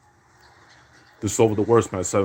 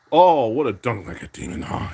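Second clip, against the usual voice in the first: normal talking voice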